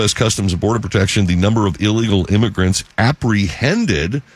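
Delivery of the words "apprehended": "apprehended" stretched out with stress on HEND; emphasized word. Real, not acted. Host reading border figures, "apprehended" pointedly emphasized. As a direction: lean hard on "apprehended", syllable by syllable. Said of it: "you notice I emphasized the word".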